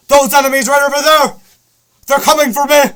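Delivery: high, very loud shout to teammates; urgent battle callout. Acted. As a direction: yell a frantic in-game warning. Acted urgent game callout to teammates.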